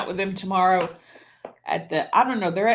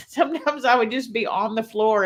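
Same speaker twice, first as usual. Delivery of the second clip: usual speaking voice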